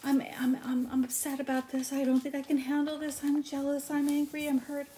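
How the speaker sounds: mock crying complaint. Mimicking Sarah crying in the audience, mock teary whimper. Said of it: "she constantly cries".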